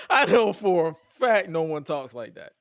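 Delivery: loud, laughing insistence; laughing disbelief. Real, not acted. Host laughing at slang no one uses, amused disbelief. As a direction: burst out insisting through a laugh.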